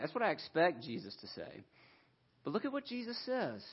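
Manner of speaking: ordinary talk, nothing marked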